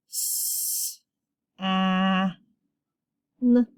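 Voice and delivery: S, a, n as three separate held sounds; sounded out. Shown as a demonstration. Teacher sounding out a closed syllable, separate S, a, n. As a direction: hiss S, hold short a, then n.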